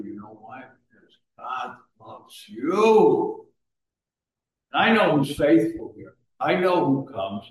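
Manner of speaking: ordinary talk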